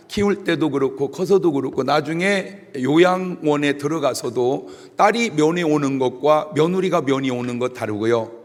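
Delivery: ordinary talk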